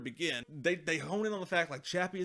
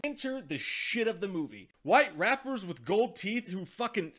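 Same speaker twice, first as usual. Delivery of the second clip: ordinary talk, nothing marked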